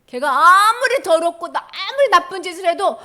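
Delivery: loud, high, forceful defence; emphatic acted divine proclamation; God's declaration. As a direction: stand up fiercely for your own. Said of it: "하나님 말씀하시는 거야".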